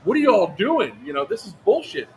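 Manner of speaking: boisterous officer. Retold or imitated. Second officer arriving at the stop, imitated boisterous manner. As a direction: act out a blustering challenge. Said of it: "he gets out acting all boisterous".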